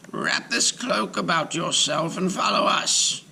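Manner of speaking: high, steady voice of a wise rabbi; old rabbi. Acted. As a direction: instruct the giant calmly but firmly. Rabbi's voice giving orders.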